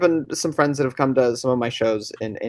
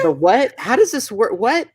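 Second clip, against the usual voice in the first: higher, baffled questions; retold confusion; 8 semitones higher than usual. Recalling the director's odd cat request, baffled higher questions. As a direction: replay his own puzzled reaction. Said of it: "And I was like".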